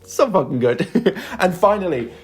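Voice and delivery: usual speaking voice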